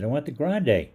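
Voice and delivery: high, loud, stubborn demand; loud insistence. Retold or imitated. Customer yelling his order at four clerks. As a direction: plant your feet and shout it up high.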